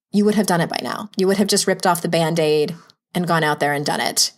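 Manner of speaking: usual speaking voice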